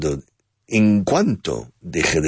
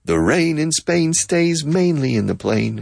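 high sing-song; 9 semitones higher than usual. Shown as a demonstration. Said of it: "All right".

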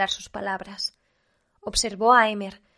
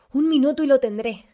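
raised pitch and volume, insistent; shrieked; 5 semitones higher, 7 dB louder than usual. A narrator's reading. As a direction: call out under pressure. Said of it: "chilló Zala".

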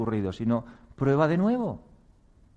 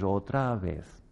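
weary sigh; 5 semitones lower, 4 dB quieter, much slower than usual. Acted. Self-talk after own mistake.

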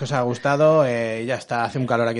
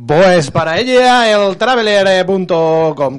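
booming prize announcer; 4 semitones higher, 6 dB louder, much slower than usual. Acted.

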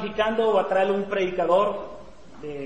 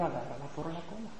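much softer voiced order; hushed command; 3 semitones lower, 12 dB quieter, faster than usual. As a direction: drop the volume for God's quiet insistence. God's order retold after objection.

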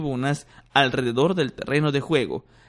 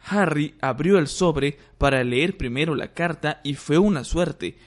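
plain reading voice

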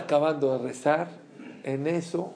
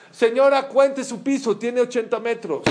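louder, higher insistence; emphatic call; 6 semitones higher, 5 dB louder than usual. Retold or imitated. Taxi driver urges the woman to count her floor, emphatic. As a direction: tell her firmly to count it.

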